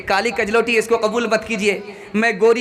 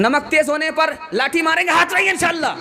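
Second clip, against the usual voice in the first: shouted rousing call; 6 semitones higher than usual. Real, not acted. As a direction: shout it out high to the crowd. Rousing the crowd to raise hands.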